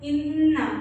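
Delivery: इन्ना with the नून held nasal for one alif; nasal ghunna. Shown as a demonstration. Teacher shows the ghunna on इन्ना. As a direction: hold the nn of इन्ना through the nose. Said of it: "नाक में आवाज़ को ... लंबा करके".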